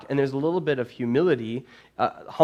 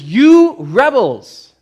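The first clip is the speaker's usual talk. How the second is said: huge, slow, high furious roar; fuming shout; 11 semitones higher, 15 dB louder, much slower than usual. Retold or imitated. Moses fuming at the gathered nation, slow furious roar. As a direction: bellow it at the whole nation.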